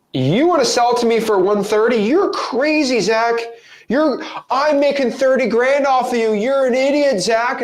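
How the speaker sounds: lightly hammed-up smug buyer; gloating buyer. Investor Dante mocking Zach over the cheap deal, mock gloating. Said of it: "he's like".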